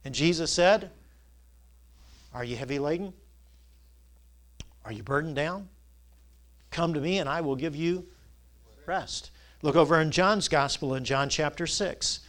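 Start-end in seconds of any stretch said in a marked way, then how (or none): 2.3-9.3 soft, low questions with long pauses; hushed invitation. Acted. Preacher voicing Jesus calling the burdened, hushed. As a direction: ask gently, almost under the breath.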